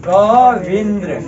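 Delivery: Kavindra said very slowly, syllable by syllable; slow teaching model Kavindra. Shown as a demonstration. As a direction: spell it out slowly for the pupils. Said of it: "क्या हो जाएगा रे?".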